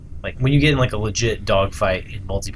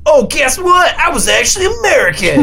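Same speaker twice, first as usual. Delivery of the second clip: much higher, loud mocking reveal; gloating taunt; 5 dB louder than usual. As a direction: gloat like a smug show-off. Hero taunts the Brits.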